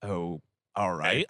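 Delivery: low, slow, flat-out mock; mock deadpan reply. Teller mocking the dull reply, slow and low. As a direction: drag it out, unimpressed.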